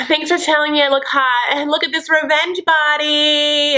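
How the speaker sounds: mimicking a preening reality star; mock-boastful Darcy. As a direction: show off in a high put-on voice. Mimics Darcy showing herself off.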